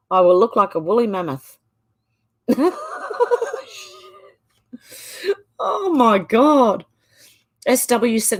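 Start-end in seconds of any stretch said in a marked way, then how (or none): 2.5-3.8 very high squealing laugh; real high-pitched giggling fit; helpless laughter. Real, not acted. Cracking up at weird comment. 5.6-6.8 slow, loud, high exclamation after laughing; real helpless amusement; amused disbelief. Real, not acted. Recovering from laughing fit.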